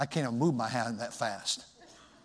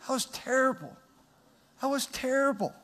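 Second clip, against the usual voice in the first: pained lament; 12 semitones higher than usual. Real, not acted. Pained memory of spanking.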